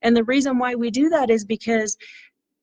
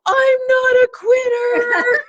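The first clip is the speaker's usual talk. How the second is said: put-on much higher, louder, tearful kid's shout; imitating a crying boy; 13 semitones higher, 5 dB louder, much slower than usual. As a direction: play a sobbing boy who refuses to quit. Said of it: "And he's like".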